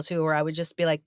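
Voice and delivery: ordinary talk, nothing marked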